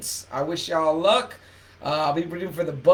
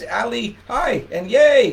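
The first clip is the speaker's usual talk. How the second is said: excited greeting; 7 semitones higher, 6 dB louder, slower than usual. Real, not acted. Excited greeting of a live viewer.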